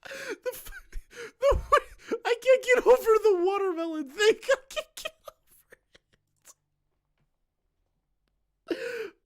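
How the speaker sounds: very high squealing words through laughter; helpless laughter. Real, not acted. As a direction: struggle to speak while cracking up. Cannot get over the joke.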